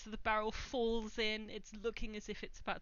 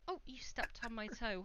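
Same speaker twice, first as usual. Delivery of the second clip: ordinary talk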